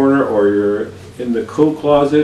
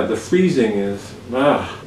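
ordinary talk, nothing marked

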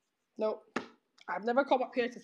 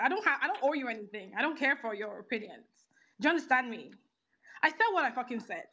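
normal talking voice

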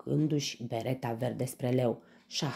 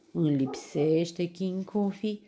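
slow, higher, louder whimper; snivelling grandma; 6 semitones higher, 5 dB louder than usual. A narrator's reading. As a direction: snivel it like a homesick granny. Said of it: "se smiorcăie bunica în batistă". (bunică smiorcăită)

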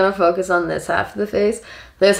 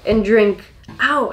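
ordinary delivery

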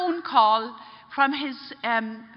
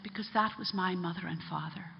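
low, soft, weighted reply; hushed, solemn disclosure; grave revelation; 5 semitones lower, 9 dB quieter than usual. Retold or imitated. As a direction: reveal it quietly, slowing at the end. Priest reveals his family was killed.

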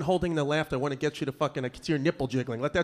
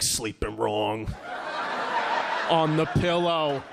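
slow, drawn-out insinuation; mock dad repeating it suspiciously; suggestive mocking echo; slower than usual. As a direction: stretch each word with heavy doubt. Dad echoes son with innuendo.

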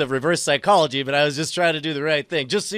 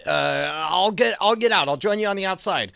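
ordinary delivery

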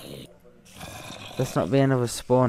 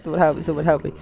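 mock panic; 5 semitones higher, 5 dB louder, much faster than usual. Real, not acted. Panicking alone near zombies.

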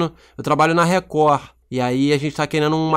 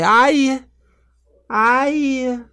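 long, high-pitched mock death wail; hammed-up drawn-out "ai" of dying; dying cry; 9 semitones higher than usual. Shown as a demonstration. Auditioning the character's death, high hammed-up wail. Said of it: "ele morre".

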